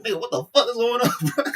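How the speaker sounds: loud, high-pitched incredulous outburst; hammed-up alarmed disbelief; mock shock. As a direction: act floored, breaking into laughter. Voicing Smoke's shock, laughing.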